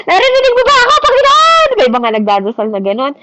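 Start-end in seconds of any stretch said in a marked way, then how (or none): 0.0-1.7 high, loud pleading wail to the Lord; mock desperate begging prayer. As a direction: beg like God can't hear you, much higher and louder. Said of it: "parang nagmamakaawa ka". (nagmamakaawang sigaw na panalangin)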